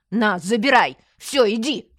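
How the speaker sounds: loud, impatient dismissal; exasperated command. Acted. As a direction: send the pest away forcefully. Exasperated policeman sends old man away.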